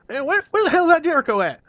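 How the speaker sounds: high, loud angry Southern yell; Cornette impression. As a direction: bellow for the missing wrestler. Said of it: "And he's like".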